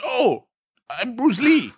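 high, exasperated mock protest; hammed-up indignant accent; uptight, wound-up. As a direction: imitate a wound-up man insisting on his name. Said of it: "so uptight, so wound up. He's like".

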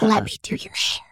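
whispered hairdresser ASMR. Retold or imitated. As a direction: hush it like an ASMR video. Describing ASMR videos.

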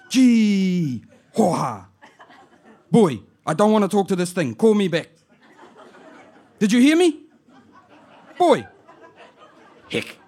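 put-on high, loud Māori auntie calling out to her boy, amused; auntie on the answer phone. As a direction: shout down the phone like a bewildered old auntie. Auntie fed up with machine.

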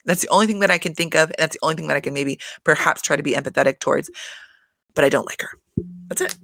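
ordinary talk, nothing marked

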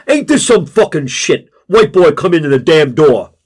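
loud, indignant complaint; angry protest. A narrator's reading. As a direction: complain loudly, offended. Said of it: "lodges a formal protest".

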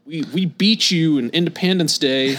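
loud, jeering schoolyard taunt; mock bully. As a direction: sneer it like a playground bully. Mocking the hostile American kids.

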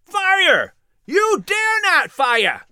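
high, loud, slowed defiant yell; angry rioter taunting the soldiers; angry crowd shout. Acted. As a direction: throw the dare back at them, loud. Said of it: "other angry voices echoed".

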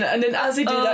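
ordinary delivery

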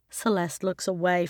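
usual speaking voice

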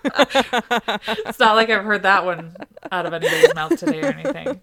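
talking through real laughter; laughing. Real, not acted. Host recovering from her co-host's creepy ASMR bit, laughing. Cracking up after ASMR teasing.

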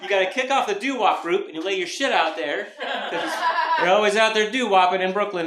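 usual speaking voice

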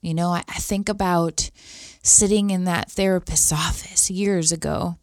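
fighting back tears, haltingly; really moved, pausing to hold it together; a little teary. Real, not acted. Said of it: "I'm getting a little teary-eyed".

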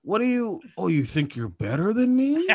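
high, whiny mock challenge; mocking a rejected guy. Mocking the rejected friend's comeback, high whiny challenge. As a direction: play a sore loser squeaking back.

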